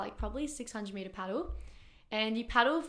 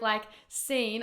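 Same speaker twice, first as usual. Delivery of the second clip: ordinary talk, nothing marked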